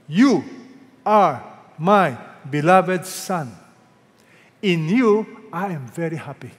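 loud, slow divine proclamation; God's voice, big and stately; voice from heaven. Acted. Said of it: "a voice came out of heaven".

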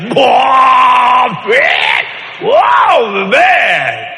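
loud high excited whoops; whooping. Retold or imitated. Three guys on plane whooping loudly. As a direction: holler like impressed guys.

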